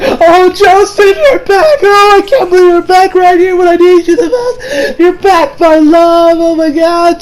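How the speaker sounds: overjoyed wail, half crying and laughing; tearful overjoyed. Retold or imitated. Josuke's mom greeting her long-lost love Joseph, tearful and overjoyed. Mom mistakes him for lover.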